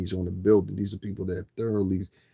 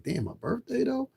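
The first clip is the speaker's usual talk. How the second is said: incredulous. Retold or imitated. Exasperated quote to himself.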